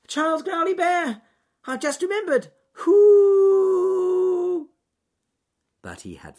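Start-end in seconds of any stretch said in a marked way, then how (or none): 0.1-4.7 very high, loud call ending in a whoop; excited shout. Acted. Rabbit shouting down to the bear from the branch, excited. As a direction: shout down to him, thrilled. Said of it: "shouted to Charles Growly Bear".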